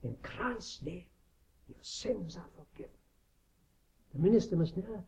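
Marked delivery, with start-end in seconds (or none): 0.0-2.9 tender absolution. Retold or imitated. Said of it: "And the minister tells him".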